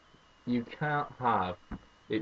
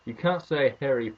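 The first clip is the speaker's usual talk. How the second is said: normal talking voice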